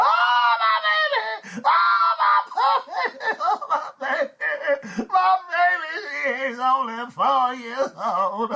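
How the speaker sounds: hammed-up high wail with sobs; mock hysterical grief, drawn out; screaming mother. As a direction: wail like a mother over her slain child. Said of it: "The poor mother comes screaming out".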